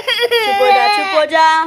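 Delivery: high, loud hushing over the crying. Real, not acted.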